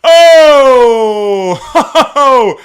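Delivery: loud, high pained cry of dismay; big exaggerated groan of regret; anguished cry. Real, not acted. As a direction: wail out in mock agony at the bad news. Asked about ticket insurance.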